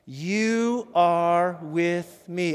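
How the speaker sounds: very slow, every word held; steady, drawn-out declaration; firm call-and-response. Shown as a demonstration. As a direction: lead the congregation word by word. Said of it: "Let's say it again".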